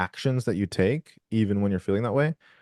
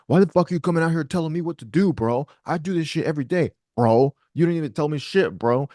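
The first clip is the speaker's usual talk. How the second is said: pitched-up imitated angry worker snapping at a client; angry bro rant; 6 semitones higher than usual. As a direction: act out losing it at the customer, bro. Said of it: "you treated them as though you're angry".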